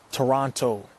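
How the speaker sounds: ordinary talk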